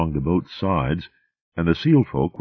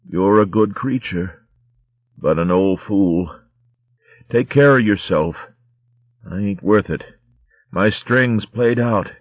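put-on weak, rough old pirate rat; a dying corsair's last words; dying sea rat voice; 4 semitones higher than usual. Dying sea rat Romska speaks.

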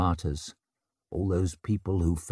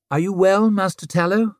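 lady's voice; 10 semitones higher, 6 dB louder than usual. Acted. Savine addresses Tallow.

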